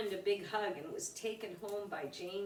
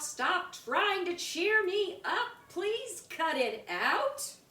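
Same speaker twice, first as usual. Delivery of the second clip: high, loud, cross shout, slowing at the end; angry shout; 9 semitones higher, 5 dB louder than usual. Acted. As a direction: stamp and yell at him. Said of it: "let out an angry shout".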